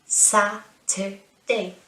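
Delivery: Saturday in three slow, separate beats; syllable by syllable. Shown as a demonstration. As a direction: say "Saturday" slowly, split as Sa-tur-day. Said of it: "good, three syllables".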